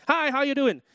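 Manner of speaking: high, run-together Southern US greeting; American greeting. Retold or imitated. Preacher mimics Southerners greeting complete strangers, American accent. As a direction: imitate a cheery American stranger, words merged into one. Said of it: "in America, especially in the South".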